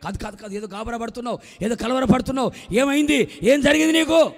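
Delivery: building from even to loud, high insistence; firm questioning. Acted. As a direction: press her harder and harder for an answer. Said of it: "కొంచెం గట్టిగా నిలదీసి అడిగింది". (కాస్త గట్టిగా నిలదీసి అడగడం)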